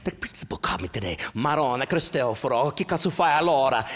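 put-on Italian accent, building to high shout; scolding Italian mother. Imitating mother after a principal's call, Italian scolding that builds. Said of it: "Then you have my mother".

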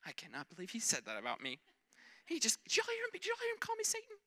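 high, put-upon whine; whiny disciple voice. Retold or imitated. Preacher voicing an offended disciple, high and whiny. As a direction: play the hurt follower who got called Satan. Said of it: "what does his friend do".

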